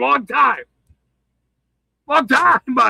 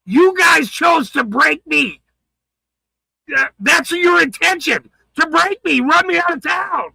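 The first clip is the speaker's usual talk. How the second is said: furious shout; 4 semitones higher than usual. Real, not acted. Streamer shouting at those who tried to break him, furious. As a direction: yell it, close to tears with anger.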